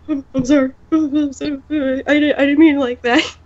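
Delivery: mock wobbly, stammered apology; wibbly apology. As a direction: imitate someone close to tears apologizing. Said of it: "like, wibbly".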